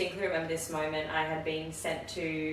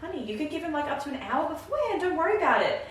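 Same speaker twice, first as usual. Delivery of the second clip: much higher, sing-song reassurance; soothing team leader; 12 semitones higher, 4 dB louder, faster than usual. Retold or imitated. Team leader reassures a panicking new grad, high and soothing. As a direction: coo at her like a kindly older nurse. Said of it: "she's like".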